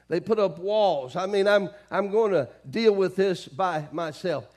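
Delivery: loud, emphatic preaching voice; forceful preaching. Real, not acted. Preacher warning about shutting people out, forceful preaching. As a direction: drive each point home to the congregation.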